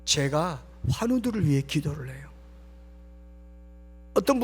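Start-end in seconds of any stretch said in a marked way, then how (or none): none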